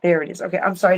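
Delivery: normal talking voice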